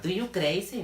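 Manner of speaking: pregunta mal hecha, aguda y fuerte; alumno confundido. Retold or imitated. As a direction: imita al alumno que se equivoca, subiendo el tono. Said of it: "Entonces van a empezar". (imitando a un alumno equivocado)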